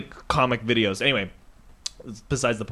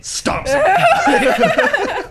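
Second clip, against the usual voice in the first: much higher, louder laughing burst; laughing punchline; 8 dB louder than usual. Real, not acted. Teller laughs at the end of his joke, laughing. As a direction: crack up at your own punchline.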